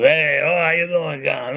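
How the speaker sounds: mock gregarious Italian greeting, drawn together in one run; lightly hammed-up hearty Italian guy. Showing the type David Chase wasn't, mock gregarious. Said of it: "wasn't a gregarious type Italian".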